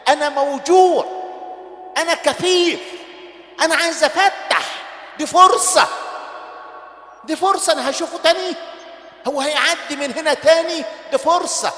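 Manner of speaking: loud, high desperate cries; desperate shouting. Acted. Blind beggar shouting over the crowd to Jesus, loud and high.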